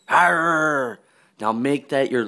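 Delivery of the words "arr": dog growl. Pretend. Growling at sleeping girlfriend.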